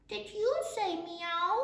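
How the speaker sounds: put-on high-pitched goat kid asking; little kid goat. A narrator's reading. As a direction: ask in a small animal's high voice. Kid asks the horse.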